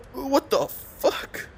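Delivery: drawn-out squeaky disbelief; stunned disbelief. Real, not acted. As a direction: drag it out in shock. Reacting in game.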